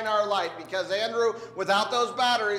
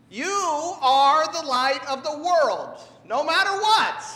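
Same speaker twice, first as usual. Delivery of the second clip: fervent proclaiming; 7 semitones higher, 7 dB louder than usual. Acted. Proclaiming to the child.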